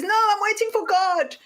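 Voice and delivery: refuse, sure of yourself; stubborn refusal. Retold or imitated. Man on roof refusing rescue, high and stubborn.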